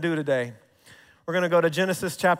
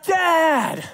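excited shout; 9 semitones higher than usual. Retold or imitated. Excited kids calling out.

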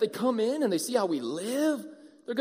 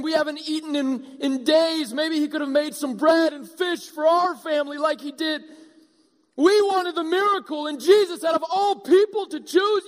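higher, louder outraged grumbling; indignant crowd; 5 semitones higher, 3 dB louder than usual. Acted. The wronged crowd protesting to Jesus, loud and indignant. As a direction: rant like a cheated townsman. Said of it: "put yourself in their shoes".

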